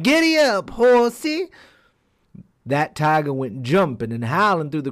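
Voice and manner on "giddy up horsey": very high, loud gleeful yell. A narrator's reading. Hare yells at tiger before the crowd, high and loud. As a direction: whoop it in triumph. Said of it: "and yelled".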